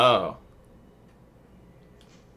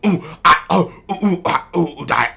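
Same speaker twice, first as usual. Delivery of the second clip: louder, higher hammed-up groans; mock death moans; 9 semitones higher, 12 dB louder than usual. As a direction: ham up a dramatic death.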